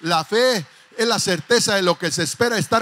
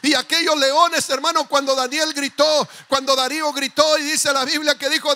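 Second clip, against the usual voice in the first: loud, rising sermon climax; full-force preaching; 4 semitones higher, 6 dB louder than usual. Acted. Pastor preaching loudly to his congregation about Daniel.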